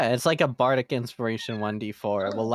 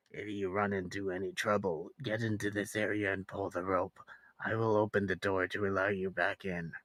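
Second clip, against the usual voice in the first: muffled voice; 5 semitones lower, 9 dB quieter than usual. Acted. NPC voice from behind door.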